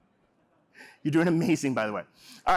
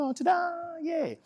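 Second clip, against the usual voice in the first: high cheerful ta-da; failure bow; slower than usual. Shown as a demonstration. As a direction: celebrate the mistake brightly. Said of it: "a thing called a failure bow".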